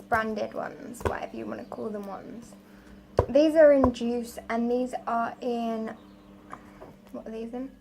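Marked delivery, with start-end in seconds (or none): 0.0-2.5 quick whispered aside; whispering. Real, not acted. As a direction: toss it off in a whisper. Aside while naming the tins.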